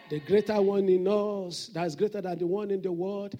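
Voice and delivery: booming prayer declaration, held words swelling to a shout; full-force preaching. Real, not acted. Preacher declaring victory to the congregation in Jesus' name, shouted. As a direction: declare it over the whole congregation at full volume.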